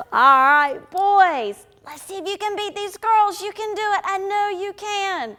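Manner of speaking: loud, high cheerleading call; rallying shout. Shown as a demonstration. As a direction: egg the children on to beat the others. Rallying boys to shout louder.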